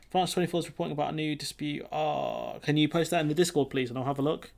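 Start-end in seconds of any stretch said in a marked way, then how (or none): none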